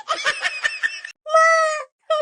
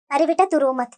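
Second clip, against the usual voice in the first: lower, softer motherly voice; soothing mother; 13 semitones lower, 4 dB quieter than usual. Acted. Mother telling her crying daughter not to cry, low and soft.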